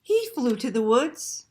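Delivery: put-on lively read-aloud to children; animated children's story narration; storytime reading. A narrator's reading. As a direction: read it like a picture book to kids. Reads picture book aloud.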